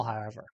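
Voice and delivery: "however" tucked away, low and soft; throwaway however. Shown as a demonstration. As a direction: toss off "however" at the end. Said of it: "diminishing the importance of "however".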